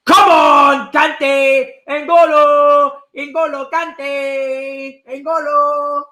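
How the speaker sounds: loud high chant of the player's name; overjoyed fan cheer; ecstatic cheering. Real, not acted. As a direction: roar his name, stretch it out. Cheering tackle.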